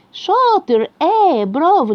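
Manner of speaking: high, loud delighted praise; eager praise. Acted. Teacher praising a child's right answer with excitement. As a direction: cheer the child on. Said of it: "teacher praising a child".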